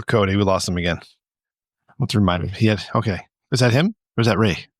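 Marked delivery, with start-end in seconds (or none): none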